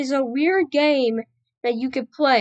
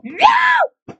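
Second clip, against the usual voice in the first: anguished rage scream; raging gamer. Real, not acted. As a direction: scream it out in frustration. Losing progress in game.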